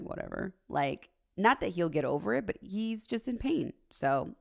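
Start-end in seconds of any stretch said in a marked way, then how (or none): 0.0-0.5 dismissive. Retold or imitated. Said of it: "I was like".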